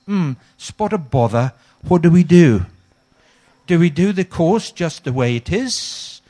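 hammed-up flustered vicar, loud; fussy English vicar. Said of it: "and he thought".

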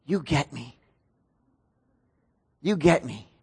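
low, hushed retold words; imitating someone quietly touched; quietly moved. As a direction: say it softly, as a quiet realization. Retold heartfelt moment at retreat.

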